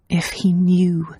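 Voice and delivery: whispered inner dread; fearful whisper. A narrator's reading. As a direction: breathe it to yourself. Her fearful inner thought.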